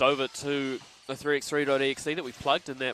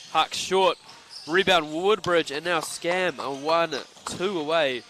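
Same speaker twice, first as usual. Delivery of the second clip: louder, slow drawn-out game call; live play-by-play; 5 dB louder, slower than usual. Real, not acted. Commentator calls the rebound to listeners, live play-by-play. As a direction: call the play, stretching the key words.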